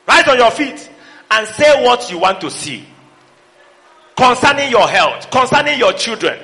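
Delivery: high, loud rising altar call; fiery preacher's climax; preaching at full force. Acted. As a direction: build them up to shout it.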